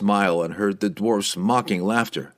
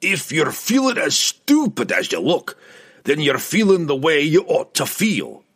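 dry dwarf's put-down, pitched up; mildly dry, higher-pitched jibe; dry remark; 7 semitones higher, 3 dB louder than usual. A narrator's reading. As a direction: deliver the insult as a gruff remark. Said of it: "Bruner remarked dryly".

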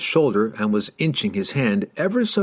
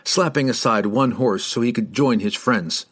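usual speaking voice

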